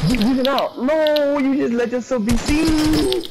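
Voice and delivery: high, scolding exasperated voice. Real, not acted. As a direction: scold them for being careless.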